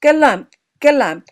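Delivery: loud, punchy glump glump; glumping boot sound. A narrator's reading. Narrator sounding Jimmy's galoshes on the hill, loud stomping glumps. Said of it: "he made a glumping sound".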